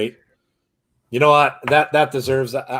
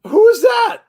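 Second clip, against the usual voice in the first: sudden high, loud "who is that"; surprised; 6 dB louder than usual. Real, not acted. Watching a wrestling promo, surprised by a cameo, high and loud. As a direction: caught off guard by a face on screen.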